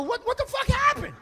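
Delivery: high-pitched, loud disbelief; shocked. Retold or imitated. Son finds the chair broken on the floor, shocked. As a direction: shout it out, stunned. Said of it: "I was shocked".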